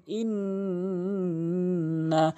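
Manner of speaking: ইন্না with the n held long through the nose; nasal ghunna demo. Shown as a demonstration. Teacher showing students the ghunna in ইন্না, long nasal hum. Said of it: "এরকম গুন্নাটি করবেন".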